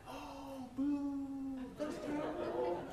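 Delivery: high, thin booing crowd voice; lightly voiced jeering fans; mock booing fans. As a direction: jeer like a disappointed team. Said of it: "the red team is like".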